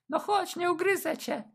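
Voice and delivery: high-pitched teasing woman; high flirty woman. Acted. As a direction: coax him in playfully. Escort coaxing.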